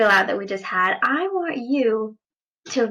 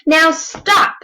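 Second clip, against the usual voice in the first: loud, higher command; king ordering the wild things to bed; Max ordering; 6 semitones higher, 7 dB louder than usual. Acted. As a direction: send them off sternly. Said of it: "Max said".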